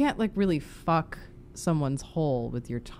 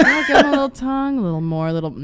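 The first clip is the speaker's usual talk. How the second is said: laughing; 9 semitones higher, 12 dB louder, much faster than usual. Retold or imitated. Voicing own thoughts while kissing.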